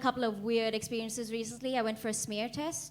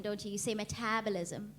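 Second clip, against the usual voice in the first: ordinary talk, nothing marked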